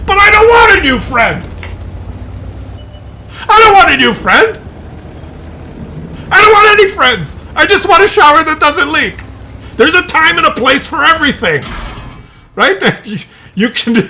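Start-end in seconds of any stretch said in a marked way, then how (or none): 0.1-11.6 exasperated rant. Real, not acted. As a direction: vent it like you've had enough of him. Ranting about the talkative worker.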